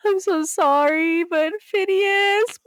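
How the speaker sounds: high, drawn-out weepy apology; mock tearful wail. Caller breaking the news that the bird died, mock wailing. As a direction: wail it slowly, far above normal pitch.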